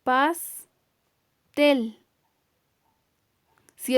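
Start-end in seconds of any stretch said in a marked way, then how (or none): 0.0-2.0 Pastel broken as PAS... TEL, with a clear pause; Pastel syllable by syllable, final TEL stressed; split into syllables. Shown as a demonstration. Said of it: "está dividida en sus dos sílabas".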